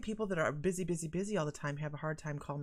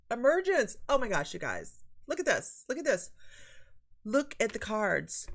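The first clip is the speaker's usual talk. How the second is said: high, loud amazed exclaim; real delighted amazement; excited surprise; 5 semitones higher, 6 dB louder than usual. Real, not acted. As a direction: gasp at the card, much higher and louder. Reading the surprising card.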